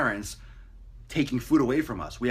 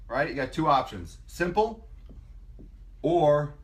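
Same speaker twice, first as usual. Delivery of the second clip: ordinary delivery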